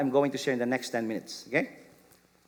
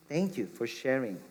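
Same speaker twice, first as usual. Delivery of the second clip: soft, low and tender; gentle answer; 4 semitones lower, 6 dB quieter than usual. Shown as a demonstration. Parent gently answering a child's doubts, softly. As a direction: gently offer to walk with them. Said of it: "A gentle answer would be".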